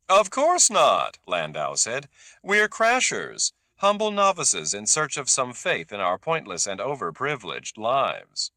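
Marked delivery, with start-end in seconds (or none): 0.1-1.2 dismissive. A narrator's reading. Landau repeats, louder and higher. 2.4-8.5 mock humility. A narrator's reading. Landau mocking his own set.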